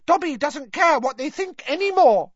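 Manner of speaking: stubborn elf declaring his freedom; Dobby tells Winky he no longer cares, high and defiant; defiant house-elf. Acted. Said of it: "said Dobby defiantly".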